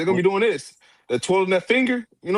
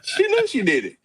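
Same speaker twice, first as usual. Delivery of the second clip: laughing; slower than usual. Real, not acted. Laughing at the replay.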